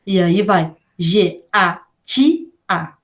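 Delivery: G-A-T-A read slowly letter by letter; teacher dictating G-A-T-A; spelling out letters. Shown as a demonstration. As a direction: spell G, A, T, A one at a time. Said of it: "mais alguma letra pra compor".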